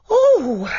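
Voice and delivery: high, loud startled oh; high frightened cry; frightened gasp. Acted. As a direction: gasp after nearly falling. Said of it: "She let out a frightened breath".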